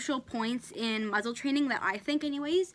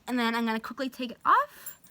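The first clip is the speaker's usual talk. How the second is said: usual speaking voice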